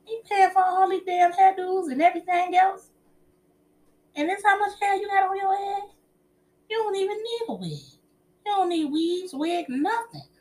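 high, loud mock complaint from him; imitating husband. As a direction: mimic the husband griping about hairdos, pitched high. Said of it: "he was like".

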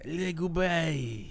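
very slow mock French "Le goodbye"; mock French accent. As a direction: stretch "Le goodbye" slowly in a fake French accent. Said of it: "French accent".

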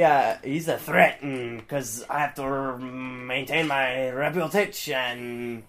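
slow, put-on French-ish villain voice; fake French accent. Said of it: "Why am I speaking French?".

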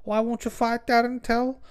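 mock Scouse question, high-voiced; heckler on the mic. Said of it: "He was just like".

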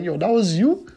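high, loud stunned question; mock surprise. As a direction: act shocked at the reveal. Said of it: "Sean Price's reaction is like".

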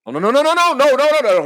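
high, loud panicked string of no; panicked pleading. Acted. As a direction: beg frantically not to be held. Panic at being put on hold.

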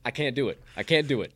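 usual speaking voice

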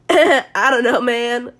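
lightly voiced SoCal surfer dude. Podcaster trying to do Connor's SoCal voice, half-hearted SoCal impression. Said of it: "try to do his SoCal voice".

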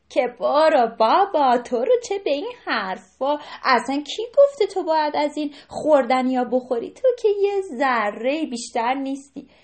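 loud, sneering taunt; mocking bully deer. Bully deer loudly taunting the skinny deer. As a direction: jeer at the thin deer, loudly.

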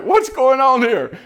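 high, loud, slow baffled question; a playful what-is-happening; mock bewildered. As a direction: act confused at the fuss. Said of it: "I'm like".

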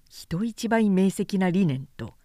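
usual speaking voice

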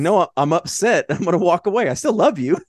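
mock-upset boyfriend, pitched high and loud; retold upset protest. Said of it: "He's just like".

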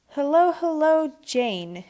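high, loud, drawn-out hello; boy eagerly greeting maid with food. Acted. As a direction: greet her with greedy delight. Edward eyeing refreshments, rubbing hands.

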